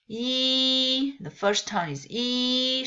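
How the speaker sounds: yi held high and level; teacher showing the first tone yi. Shown as a demonstration. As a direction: keep yi straight and horizontal. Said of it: "straight, horizontal".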